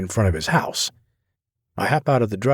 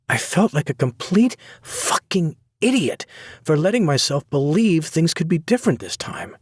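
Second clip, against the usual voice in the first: high, bitter self-reproach; bitter young woman; 8 semitones higher than usual. A narrator's reading. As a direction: spit it at herself. Sloane berating herself.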